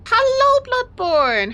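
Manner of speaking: loud, high hello to a new viewer; sing-song hello; excited greeting. Real, not acted. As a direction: call out the welcome brightly.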